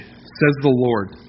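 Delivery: slow, weighty, each word set apart; slow solemn emphasis. Shown as a demonstration. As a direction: lean on every word like a proclamation. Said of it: "repeated scripture phrase, slowed".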